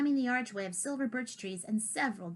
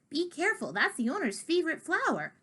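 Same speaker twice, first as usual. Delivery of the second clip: higher urgent warning; crying out; 6 semitones higher than usual. Acted. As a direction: warn them sharply. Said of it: "Pumpkin cried".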